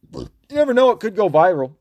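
burpy voice, burping while talking. Real, not acted. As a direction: talk through burps. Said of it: "Sorry, I'm burping like crazy".

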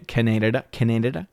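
Canada said as kuh-NAY-duh-duh, questioning; deliberate mispronunciation. Shown as a demonstration. Host fumbles a joke for Canadian viewers, Canada deliberately mispronounced kuh-NAY-duh-duh. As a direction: stress a long NAY in Canada, extra syllable after. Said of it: "I'm trying to say Canada wrong".

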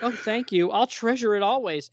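high-pitched starstruck fan woman; hammed-up adoring admirer; gushing fan. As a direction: beg for the autograph breathlessly. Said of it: "And she's like".

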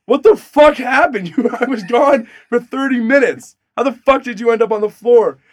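high, loud panicked roommate yelling; alarmed shout. Retold or imitated. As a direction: shout like you just found your friend in blood. Roommate finds him bleeding on floor.

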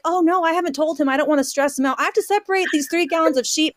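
stubborn labor excuse. Retold or imitated. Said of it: "I'm like".

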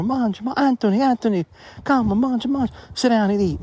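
put-on Italian accent, coaxing a grandson to the table; grandmother's Italian accent. Grandson recalls Italian grandmother urging him to eat, Italian accent. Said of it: "This Italian, Italian accent".

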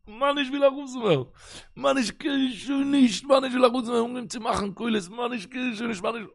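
high wailing plea, voice cracking; crying out. Retold or imitated. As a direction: wail it like a worn-out boy begging. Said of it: "קולות, אין וויינען". (ווייניק געשריי, הויך)

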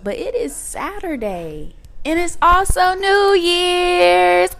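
high, slow, excited reveal. Real, not acted. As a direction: stretch it out with excitement. Excited New Year announcement.

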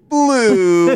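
very high, drawn-out toy voice; high mock-perky call; peppy toy lady. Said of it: "she's so much more peppy".

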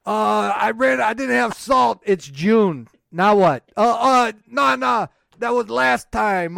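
loud, panicky old Frenchman in a bad French accent. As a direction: shout it out as a bumbling Frenchman with a fake French accent. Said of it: "I can't do a good French accent".

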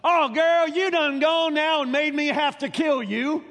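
loud, high, folksy scolding father; folksy paraphrase of the father. Retold or imitated. Preacher voices Jephthah scolding his daughter, loud folksy exasperation. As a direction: scold her like an exasperated daddy. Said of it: "That's what he says".

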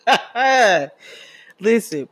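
laughing through the words. Real, not acted. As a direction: crack up while talking. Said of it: "I'm crying laughing".

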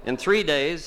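ordinary talk, nothing marked

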